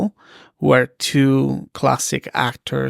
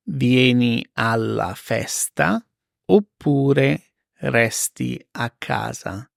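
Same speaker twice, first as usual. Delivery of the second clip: slow, spaced repeat; careful slow teacher read; slow and clear; slower than usual. Shown as a demonstration. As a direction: spell it out slowly for learners. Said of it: "slow repeat for learners".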